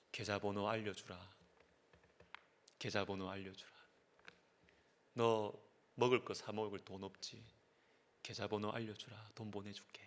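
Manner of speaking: low, hushed imitation of a tender father; warm fatherly words. Father's last voicemail to his runaway son, warm and hushed. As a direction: speak softly and gently, as a father relenting. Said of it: "이 따뜻한 말 한마디에".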